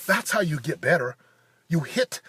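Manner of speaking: ordinary delivery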